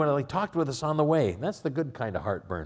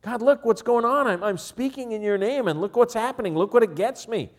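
exasperated plea; 9 semitones higher, 4 dB louder than usual. Retold or imitated. Jeremiah complaining to God.

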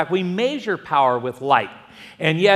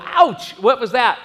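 higher, startled yelp; a jolt of pain and surprise; startled ouch; 6 semitones higher, 3 dB louder than usual. Retold or imitated. Pastor retelling stubbing his toe at night, startled yelp. Kicking something in the dark.

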